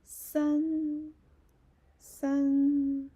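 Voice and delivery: san held high and loud, level; teacher models san for the class to copy; san demo. Shown as a demonstration. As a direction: call out san high and steady. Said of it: "and then".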